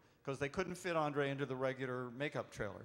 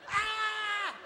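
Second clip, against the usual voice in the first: high, loud mock shriek of a scared kid; child scream; 8 dB louder than usual. Dad imitates his daughter screaming at the giant, high and loud. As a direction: shriek like a terrified toddler. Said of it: "started screaming".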